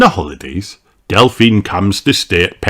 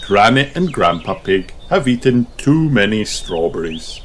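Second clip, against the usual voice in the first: ordinary delivery, nothing marked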